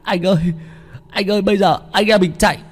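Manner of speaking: high, fast panicked whisper-shout; panicked sidekick. Acted. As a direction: blurt it out in panic. Panicked sidekick asks boss.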